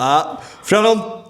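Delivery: slurred, slowed tipsy bid; drunk bid. Retold or imitated. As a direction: call out the bid like a drunk bloke. Drunk bidding at auction.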